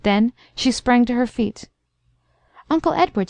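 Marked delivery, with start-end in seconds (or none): 2.7-3.3 higher-pitched call to her uncle; mildly urgent cry, pitched up. A narrator's reading. Girl calls to her uncle about the waiting sleigh, crying out. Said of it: "she cried".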